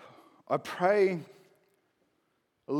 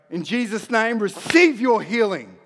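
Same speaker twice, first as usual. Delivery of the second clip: higher and louder, a bold declaration; commanding prayer; 5 semitones higher, 5 dB louder than usual. Shown as a demonstration. Preacher commanding healing over the sick, loud and bold. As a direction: speak with authority, no asking. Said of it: "We go".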